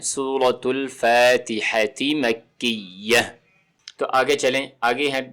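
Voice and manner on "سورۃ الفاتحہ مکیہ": full title سورۃ الفاتحہ مکیہ, syllables drawn out; measured, precise reading of سورۃ الفاتحہ مکیہ; careful tajweed. Shown as a demonstration. Teacher giving the surah's full name, careful tajweed. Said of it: "تو ہم کہتے ہوتے ہیں".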